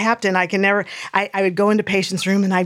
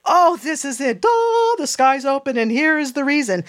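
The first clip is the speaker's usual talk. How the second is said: high, hammed-up awe; mock grand revelation; 6 semitones higher than usual. Woman mocking the wait for a great revelation, high mock awe. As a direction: exclaim it like the heavens just parted. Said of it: "some great revelation, like".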